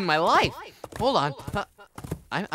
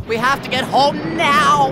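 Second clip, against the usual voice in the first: urgent; 4 semitones higher, 7 dB louder, slower than usual. Acted. Storm about to break.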